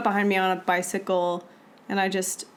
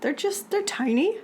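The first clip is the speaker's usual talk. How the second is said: high, protesting voice; pitched-up excuse; defensive; 10 semitones higher than usual. Real, not acted. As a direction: insist it's nothing. Defending small mistakes.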